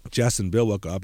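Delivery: ordinary talk, nothing marked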